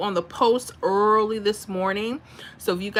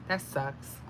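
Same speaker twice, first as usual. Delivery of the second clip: lower, hushed disappointment; quiet dismay; 7 semitones lower, 11 dB quieter than usual. Real, not acted. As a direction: sigh it out softly. Sympathy for evicted viewer.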